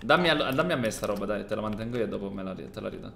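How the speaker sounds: ordinary talk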